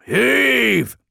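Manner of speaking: high, loud, sustained yell. A narrator's reading. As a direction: yell it long to the haulers. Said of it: "yelled Squattumus".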